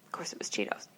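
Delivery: hushed unvoiced aside; whisper. Real, not acted. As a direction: whisper it under your breath.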